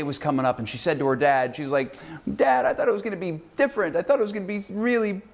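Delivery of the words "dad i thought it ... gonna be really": put-on high, pleading teen girl voice; mock the upset teenager whining to Dad; whiny teenage girl. Cheerleader complaining to her dad, high whiny teen voice. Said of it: "upset like a teenager".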